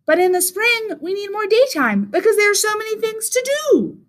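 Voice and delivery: high, cheery bird voice; high-pitched storybook bird; little bird. A narrator's reading. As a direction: reassure a friend in a high bird voice. Bird argues for spring.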